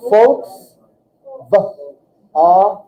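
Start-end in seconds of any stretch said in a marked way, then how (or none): 0.1-0.8 "Volks-" begun with an F sound, louder. Shown as a demonstration. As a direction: punch out "Volks-" as "folks". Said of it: "V makes an F sound".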